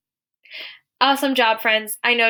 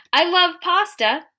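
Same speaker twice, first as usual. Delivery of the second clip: high, slow, gushing exclamation; excited; 9 semitones higher, 4 dB louder, slower than usual. Shown as a demonstration. Teacher voicing someone telling how much they like pasta, excited. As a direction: gush with drawn-out delight. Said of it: "Someone is excitedly telling us".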